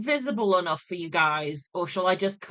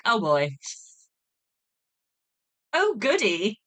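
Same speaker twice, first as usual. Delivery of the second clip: sarcastic delight; 3 semitones higher than usual. Retold or imitated. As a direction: fake enthusiasm, plainly dreading it. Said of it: "and I'm like".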